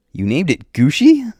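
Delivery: high, loud disbelief; incredulous. Acted. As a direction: voice shoots up on the question. Incredulous at the monster's pet name.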